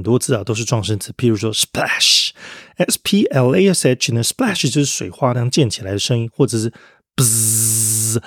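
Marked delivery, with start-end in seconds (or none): 4.2-4.7 drawn-out, sound-effect "splash"; splashing sound word. Shown as a demonstration. Teacher demonstrating a sound word to learners, splashy "splash". 7.2-8.2 long buzzing "bzz"; bee buzz. Shown as a demonstration. Teacher imitating a bee for learners, sustained buzz. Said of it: "那就是蜜蜂在飞的声音".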